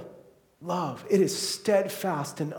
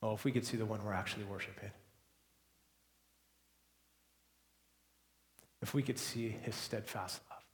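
much softer, lower and wistful; hushed longing; 8 semitones lower, 14 dB quieter, faster than usual. Real, not acted. Preacher turning from football to worship, a hushed longing. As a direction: pull them in close, softly. Quiet reflective close after excitement.

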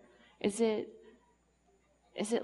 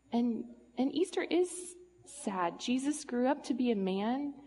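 usual speaking voice

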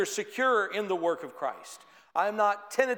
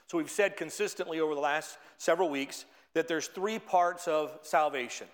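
ordinary talk, nothing marked

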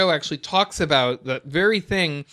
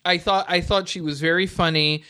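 ordinary delivery

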